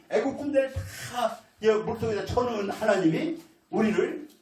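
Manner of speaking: rally the crowd with rising conviction; preaching at full force. Acted. Preaching Caleb's bold call.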